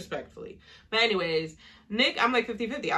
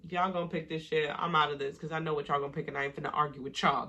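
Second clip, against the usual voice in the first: low, quick, checked-out mumble; lazy shrug of a voice, lower and softer; imitating Austin; 5 semitones lower, 5 dB quieter, faster than usual. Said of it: "Austin must have been like".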